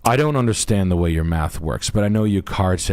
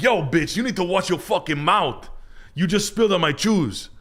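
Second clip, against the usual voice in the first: put-on high Dominican-accent comeback; buddy talking back; 10 semitones higher than usual. The buddy telling off the girl in the club. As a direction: bark back at her in the accent. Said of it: "And I was like".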